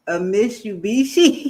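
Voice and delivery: Mitsubishi said as "Mishubishi", slow and careful; mispronounced Mitsubishi. Shown as a demonstration. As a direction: stumble through Mitsubishi. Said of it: "cannot say it".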